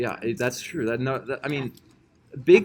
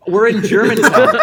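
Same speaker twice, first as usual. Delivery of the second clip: laughing through the words; laughing loud protest; 7 semitones higher, 10 dB louder than usual. Real, not acted.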